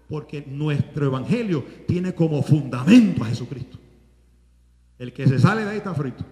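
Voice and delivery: loud, low warning, fast at the end; loud preacher's warning; preaching warning. Real, not acted. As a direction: shout the warning at the congregation. Preacher warns the congregation.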